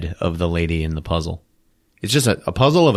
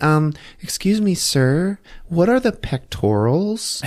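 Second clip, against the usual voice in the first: higher, lightly voiced girl's question; mock-innocent student; 7 semitones higher, slower than usual. Student asks professor a trick question, lightly mock-innocent. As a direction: imitate a student politely setting up a teacher.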